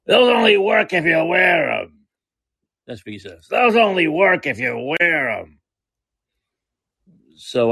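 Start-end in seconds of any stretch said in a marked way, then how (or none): 0.1-2.1 drawn-out heckling yell, pitched up; mock yell at a passerby, stretched and high; imitated yell. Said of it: "randomly yells at me". 3.5-5.6 long, slow heckler's call, raised pitch; stretched-out mock holler; imitated yell. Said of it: "He just randomly yells at me".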